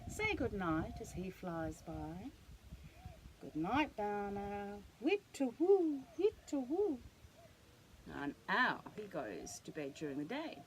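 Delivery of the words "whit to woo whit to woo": high, loud, slow hoot; owl hoot. Pretend. Reading to a child, owl hoots slowly. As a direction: call out like an owl. Owl call in bedtime book.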